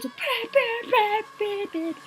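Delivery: loud, very high-pitched beeping; high imitated crowd beeps. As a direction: beep shrilly like a whole rave crowd. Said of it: "and then everyone goes".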